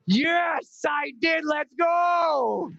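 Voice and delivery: high, celebratory cheer; hammed-up victory cry; acted triumph. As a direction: crow it like a winner in the hero shot. Acting out triumph after landing.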